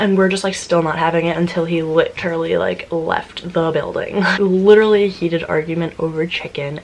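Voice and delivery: ordinary talk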